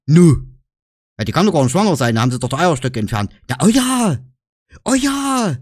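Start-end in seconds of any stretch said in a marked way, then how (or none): 0.1-0.5 Nu said short and loud; clipped Saxon Nu for yes. Shown as a demonstration. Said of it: "zwei verschiedene Arten, Ja zu sagen". 3.5-5.6 higher, emphatic oh ja; oh ja lifted high in emphatic agreement; insistent Saxon oh ja; emphatic yes. Shown as a demonstration. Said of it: "das ist eine Verstärkung".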